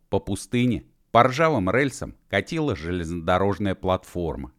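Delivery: usual speaking voice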